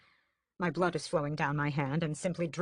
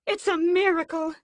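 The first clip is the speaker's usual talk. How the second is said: much higher, louder joyful cry; exclaiming; 13 semitones higher, 9 dB louder than usual. A narrator's reading. Mother exclaiming as Raoul wakes, overjoyed. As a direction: proclaim it joyfully.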